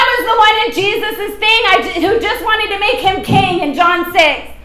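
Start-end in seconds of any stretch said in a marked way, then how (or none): none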